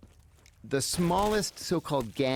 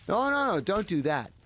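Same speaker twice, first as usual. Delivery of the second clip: higher, louder rush of no, no, no; alarmed objection; 9 semitones higher, 3 dB louder than usual. Retold or imitated. Lieutenant stopping the prisoner from hurting the imam, alarmed. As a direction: jump in fast to stop him.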